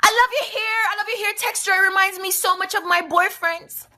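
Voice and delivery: high, gushing fan voice; boy raving about her hair texture; gushing compliment. Retold or imitated. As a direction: coo over the hair excitedly. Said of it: "this boy start scream".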